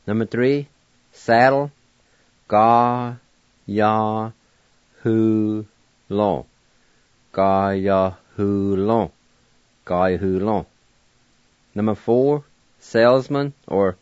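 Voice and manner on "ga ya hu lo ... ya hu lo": Ga-ya-hu-lo stretched slowly, each syllable even; teacher drilling Ga-ya-hu-lo; model pronunciation. Shown as a demonstration. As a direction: drag out Ga-ya-hu-lo for learners to copy. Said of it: "like this".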